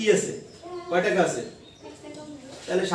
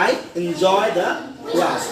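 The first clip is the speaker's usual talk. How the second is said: slow "I enjoy the class", "class" set apart and low; pause, then drop onto "class"; 3 semitones lower, much slower than usual. Shown as a demonstration. Teacher modelling a positive statement, low falling "class". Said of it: "down হবে না up হবে?".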